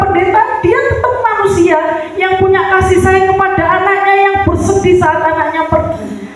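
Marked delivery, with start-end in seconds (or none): none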